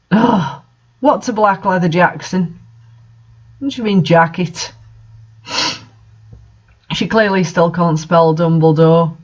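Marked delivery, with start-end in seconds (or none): none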